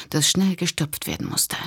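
plain reading voice

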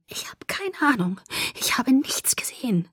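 barely mouthed whisper; only moving her lips, whispering. Acted. Alice mouthing to Edward, whispering. Sie bewegte nur die Lippen.

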